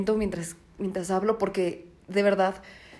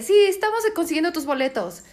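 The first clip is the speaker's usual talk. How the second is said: higher, lightly voiced website notice; mock website message; 7 semitones higher than usual. Mocking the crashing ticket site, a light chirpy notice. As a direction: parody the ticket site's cheery message. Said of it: "te decía".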